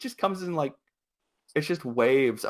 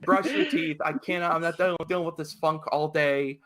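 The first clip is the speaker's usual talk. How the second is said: bossy parent; 6 semitones higher than usual. Retold or imitated. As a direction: order the kids upstairs. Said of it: "I was just like".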